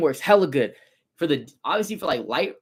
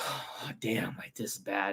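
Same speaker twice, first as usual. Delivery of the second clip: worried whisper; 5 semitones lower, 12 dB quieter, slower than usual. Retold or imitated.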